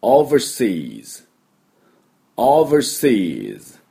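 overseas very slowly, long O and long SEAS; model English pronunciation. Shown as a demonstration. Teacher introducing the word for abroad, slow model pronunciation. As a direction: stretch overseas out for learners in English. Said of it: "これですね".

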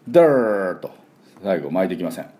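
ordinary delivery

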